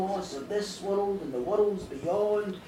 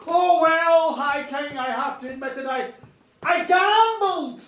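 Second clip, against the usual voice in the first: loud, slow admission; 3 semitones higher, 9 dB louder, slower than usual. Acted. Monk admitting gambling.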